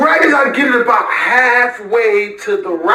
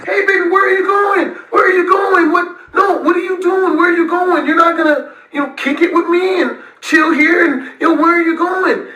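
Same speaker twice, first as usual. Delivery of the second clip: higher mock pleading girlfriend voice; clingy girlfriend; 5 semitones higher than usual. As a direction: whine after him to come back. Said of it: "she starts following behind me".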